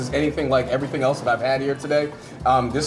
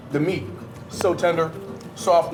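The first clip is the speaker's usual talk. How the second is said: ordinary talk, nothing marked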